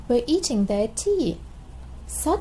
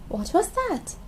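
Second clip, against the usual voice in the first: ordinary delivery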